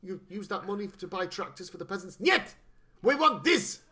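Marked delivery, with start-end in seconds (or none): 2.2-3.8 high, loud hammed-up Russian general; mock general demand. Mocking Berkoff's general demanding the revolving room, hammy shout. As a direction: stamp your foot, Russian general demanding.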